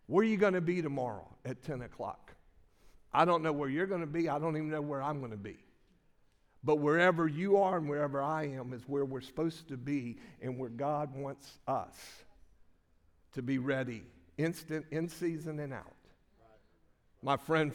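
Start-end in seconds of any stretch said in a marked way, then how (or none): none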